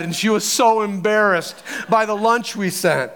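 usual speaking voice

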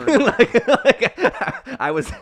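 high squeal of laughter; breaking into giggles; laughing. Real, not acted. Cracking up at the joke.

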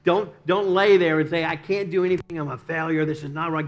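loud, rousing order to get up; God shouting a man out of bed; forceful command. Acted. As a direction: bark it like a coach rousing a team. Preacher voicing God commanding.

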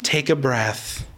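low, slow, soothing dad; calm fatherly response. Acted. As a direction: gently settle an upset child, acted out. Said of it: "you know how I responded?".

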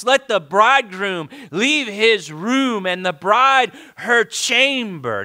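rousing pulpit call; scripture proclamation. Acted. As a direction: summon the whole congregation with each command.

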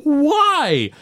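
high-pitched wail of dismay; retold high, despairing outburst; mock freak-out. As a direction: cry it out high, imitating a panicked dad. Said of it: "And Homer freaks out".

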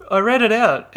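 loud, high excited jab. Real, not acted. Excited accusation.

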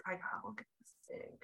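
a secret reminder, whispered; whispered thought. Retold or imitated. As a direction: whisper it to yourself. Inner thought told as quote.

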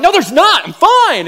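high, loud, laughing denial; mock protest. To his wife about a stray hair, high laughing protest. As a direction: squeal it out like a kid caught. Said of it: "And I'm like".

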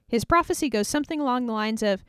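ordinary talk, nothing marked

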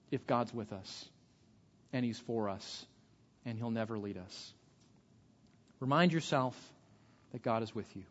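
softly reassuring the congregation; soft assurance. Real, not acted. Preacher dropping to assurance.

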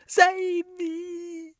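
very high, loud scream for help; drowning woman screaming for rescue; terrified scream. A narrator's reading. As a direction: beg to be saved, full panic. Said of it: "she screamed".

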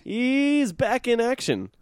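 high, slow drawn-out hype; hammed-up announcer. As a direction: stretch it like a trailer voice.

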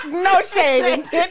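said through laughter; giggling the words out; laughing. Real, not acted.

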